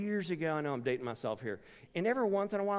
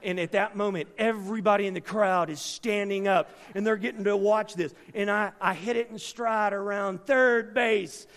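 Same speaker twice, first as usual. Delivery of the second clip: loud, rising, excited build; preaching at full force; 5 semitones higher, 9 dB louder than usual. Acted. Preacher building suspense for the congregation, loud and rising. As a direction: pile on the drama toward the call.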